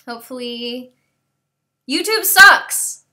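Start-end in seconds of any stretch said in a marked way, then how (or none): 1.9-3.0 loud, slow, high mock yell; fed up with YouTube, slow loud mock yell; mock outburst. Real, not acted. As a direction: drag it out, loud and mock-outraged. Frustrated jab at YouTube.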